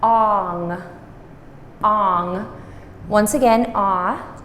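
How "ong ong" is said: ong with a rounded o and a nasal ng, no a sound; Khmer word ong. Shown as a demonstration. Teacher models ong for the class, slow careful pronunciation. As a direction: demonstrate ong with a rounded vowel. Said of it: "So I want this to say".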